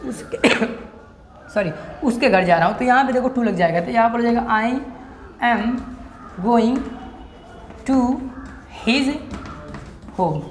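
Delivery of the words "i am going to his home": each word spaced out slowly for students to note; slow classroom dictation of an English sentence. Shown as a demonstration.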